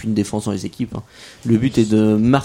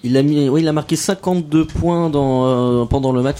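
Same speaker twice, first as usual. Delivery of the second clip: ordinary talk, nothing marked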